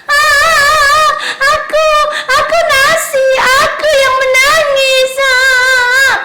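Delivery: high, loud sing-song sobbing; hammed-up storybook crying, high and loud; crying rice. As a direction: wail like a sad little rice grain. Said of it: "katanya nasi menangis".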